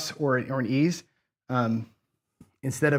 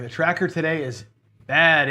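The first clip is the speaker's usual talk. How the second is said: ordinary delivery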